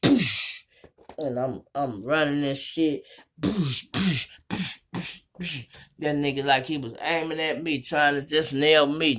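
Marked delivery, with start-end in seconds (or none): 0.0-0.6 high mouth-made gun blast; imitated shot; gunshot noise. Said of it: "imitated gunshot". 3.4-5.7 a high run of mouth-made shots; imitated gunfire; rapid gunfire noises. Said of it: "imitated gunfire burst".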